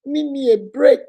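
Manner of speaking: mother mimicking her little girl's plea; imitating little daughter. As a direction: whine it like a small child. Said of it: "She say".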